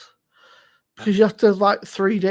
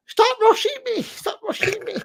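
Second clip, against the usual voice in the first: high, squeaky cry of protest; mock exasperated husband. Husband mocking his wife's rushing, high and whiny. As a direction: whine it back like a hounded husband. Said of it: "I said".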